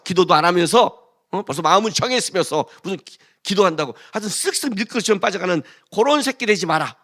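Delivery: usual speaking voice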